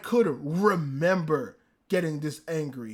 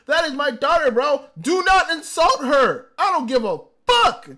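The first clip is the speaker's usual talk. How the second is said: loud, high, fired-up insistence; protective anger; 6 semitones higher, 5 dB louder than usual. Real, not acted. Fan defending the character like his own daughter, angry. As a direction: defend her like an outraged parent, voice raised. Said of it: "I got so angry".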